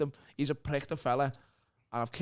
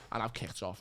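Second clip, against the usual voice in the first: normal talking voice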